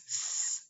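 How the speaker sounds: long unvoiced s hiss; hissed s sound. Shown as a demonstration. Teacher sounding out the s for the class. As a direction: hiss the s like a snake. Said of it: "If you said s".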